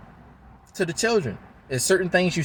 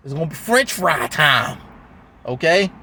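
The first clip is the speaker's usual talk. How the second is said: slower, drawn-out mock menace; aggressive and scary; 2 semitones higher than usual. Shown as a demonstration. Dad announcing a fun outing with his daughter, aggressive and scary. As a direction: make it sound like an aggressive threat. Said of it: "It sound aggressive and scary".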